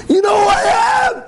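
high-pitched imitated scream. Stranger screaming at a shopper in Target, top-of-the-lungs imitated scream. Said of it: "Screaming on top of his lungs".

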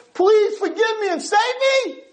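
high, strained plea for mercy; desperate plea. Retold or imitated. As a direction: beg to be saved. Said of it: "davened to Hashem".

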